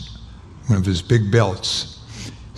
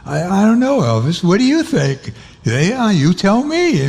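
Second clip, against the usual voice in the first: laughing; 9 semitones higher than usual. Retold or imitated. Laughing exchange with Elvis.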